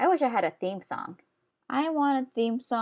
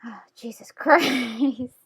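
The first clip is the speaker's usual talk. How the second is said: slow, breathy whisper broken by a laugh; hushed giggling "oh Jesus Christ"; whispered laughing dismay; 3 semitones higher, 5 dB louder, much slower than usual. Real, not acted. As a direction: laugh under your breath at the mess.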